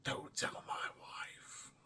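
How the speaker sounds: conspiratorial whisper. Real, not acted. Hiding the price from his wife, whispered aside. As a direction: whisper it like a guilty secret.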